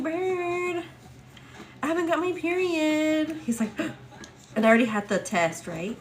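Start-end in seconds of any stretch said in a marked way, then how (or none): 0.0-3.4 anxious excited news. Retold or imitated. Waking husband with news.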